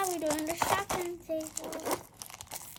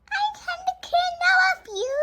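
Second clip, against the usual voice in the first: toy voice; 14 semitones higher, 6 dB louder than usual. Pretend. Toy offers friendship.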